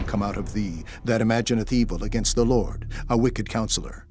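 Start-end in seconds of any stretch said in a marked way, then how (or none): none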